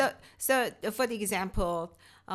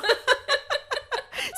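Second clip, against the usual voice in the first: high, loud burst of laughter; big laugh; 4 dB louder than usual. Real, not acted. Shared laugh over mistake.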